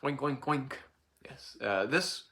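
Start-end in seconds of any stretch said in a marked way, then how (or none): 0.0-0.7 pig oink imitation. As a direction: do a pig's oink for the kids. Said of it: "make a sound like".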